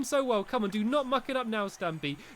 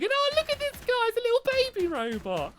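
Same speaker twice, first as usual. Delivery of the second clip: cutesy coo; 13 semitones higher than usual. Real, not acted. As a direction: coo at it like a tiny pet. Cooing at a tiny robot.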